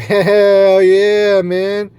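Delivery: high, loud triumphant whoop; defiant hell yeah. Retold or imitated. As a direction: shout it proud like you mean it. Cheering about his crazy deeds.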